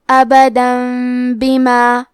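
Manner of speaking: ابداً بما recited slowly, the تنوین turned into a light میم with ghunna; iqlab with ghunna. Shown as a demonstration. Tajweed teacher demonstrating iqlab, slow recitation. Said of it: "تنوین کو ہلکی میم میں بدل کے اور غنہ کے ساتھ".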